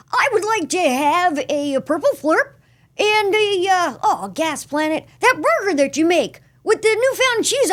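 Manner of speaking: put-on chirpy kid inventor placing a fast-food order; Jimmy Neutron voice. Host asks for Jimmy Neutron ordering food, high eager cartoon boy voice. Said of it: "Can you do a little, uh, Jimmy Neutron ordering at McSparky's?".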